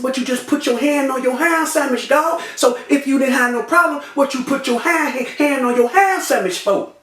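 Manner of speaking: high-pitched retold challenge; challenging. Victim questions the man with the gun, challenging.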